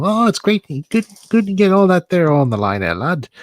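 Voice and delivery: high, loud Northern English inventor voice; Wallace impression. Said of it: "and Wallace is saying".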